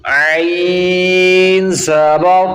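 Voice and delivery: slow sung spelling of the letter; drawn-out recitation. Shown as a demonstration. Teacher chanting letters for a child, slowly. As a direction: stretch each syllable like a chant. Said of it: "ملاؤ".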